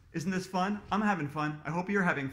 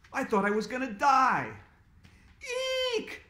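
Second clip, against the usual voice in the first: put-on high, loud frightened kid; scared cry; 7 semitones higher, 4 dB louder than usual. A narrator's reading. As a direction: yelp it out in fright. Kids terrified by bear.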